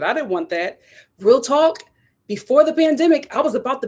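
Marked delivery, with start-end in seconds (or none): none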